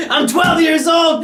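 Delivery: high excited shout, slowed down; excited kid shout. Retold or imitated. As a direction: yell it like a hyped twelve-year-old. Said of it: "And I thought".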